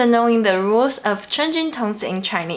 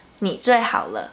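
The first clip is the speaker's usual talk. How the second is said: "你最好了" quicker, as one joined phrase; natural speed. Shown as a demonstration. Teacher repeats the example for learners, joined up and quicker.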